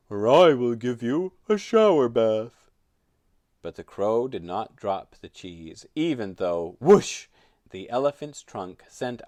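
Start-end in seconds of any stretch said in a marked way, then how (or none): 0.1-2.7 very loud, trumpeting elephant voice; little elephant. A narrator's reading. Elephant threatening the crow with water, loud. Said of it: "said the elephant". 6.8-7.3 loud, high gushing whoosh; imitated whoosh. A narrator's reading. Storyteller voicing the elephant's water jet, whooshing.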